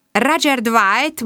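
ROGER DWIGHT slow and high, rising; questioning echo. A narrator's reading. Inspector echoing the name Roger Dwight, slow and high in question.

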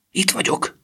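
high, breathless whisper; breathless, hushed arrival; excited panting. A narrator's reading. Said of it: "mondta izgatottan lihegve".

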